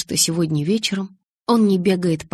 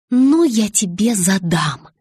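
louder, drawn out word by word; deliberate, weighty scolding; slow emphatic threat; 4 semitones higher, 5 dB louder, slower than usual. A narrator's reading. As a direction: stress each word as a warning. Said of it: "медленно произнесла я".

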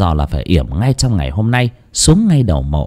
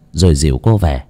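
usual speaking voice

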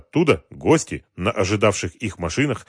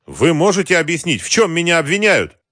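high, raised-voice protest; indignant demand; 9 semitones higher, 5 dB louder than usual. A narrator's reading. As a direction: demand the charges, affronted. Arrested president demands the charges. (возмущённо, повышенным голосом)

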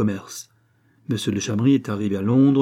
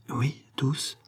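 softer, choked, barely voiced; strangled stammer; 3 semitones higher, 6 dB quieter than usual. A narrator's reading. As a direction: force the words out through a tight throat. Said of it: "d'une voix étranglée". (balbutier d'une voix étranglée)

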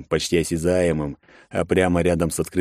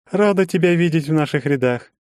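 put-on high girl voice; 10 semitones higher than usual. A narrator's reading. Shak-Ar continues greeting.

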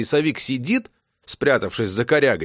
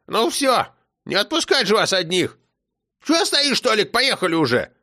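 put-on high commanding old woman; grandma ordering Tolik to start; bossy grandma; 9 semitones higher than usual. A narrator's reading. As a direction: order them to drive. Grandma bossing everyone.

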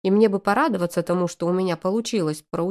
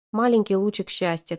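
ordinary delivery, nothing marked

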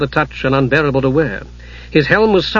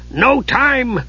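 dwarf voice; 9 semitones higher than usual. Acted. Dugan voiced.

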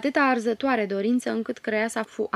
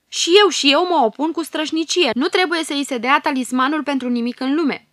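much higher and louder furious protest; fierce outburst; 7 semitones higher, 11 dB louder than usual. A narrator's reading. Lion fairy to the queen, a much louder furious outburst. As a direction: explode in fiery refusal.

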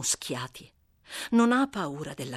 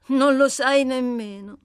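put-on a louder, higher, brisk voice for the scrappy girl; 6 semitones higher, 9 dB louder than usual. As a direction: bold and cheeky, talking back fast. Amy questions Seth. (ragazza sfacciata, voce più alta e forte)

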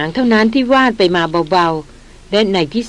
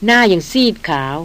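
ordinary delivery

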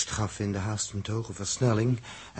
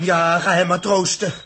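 loud, higher, sneering; jealous jab; 8 semitones higher, 8 dB louder than usual. Acted. Dirk sneering at Els as she goes to Joost, loud jealous jab. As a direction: throw the words at her in jealousy.

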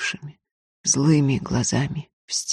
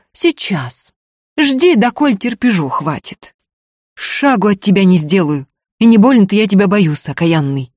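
loud, raised mocking refusal; angry, scornful defiance; defiant retort; 5 semitones higher, 7 dB louder than usual. A narrator's reading. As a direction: throw it back, unafraid. Устинья дерзко отвечает в ссоре.

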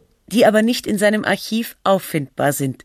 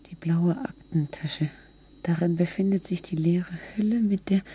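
hushed whisper, softer than usual; 7 dB quieter than usual. Acted. Narrator noting what the blue briefcase holds, whispering. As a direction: whisper it under your breath. Narrator murmurs text into recording.

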